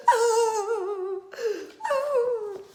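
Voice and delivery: high loud hammed-up sobs; sobbing. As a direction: wail like a weeping mother. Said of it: "She began to sob".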